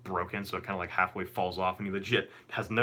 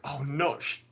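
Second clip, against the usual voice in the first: alarmed dismay; 7 semitones higher, slower than usual. Retold or imitated. Caught lying, reliving dismay.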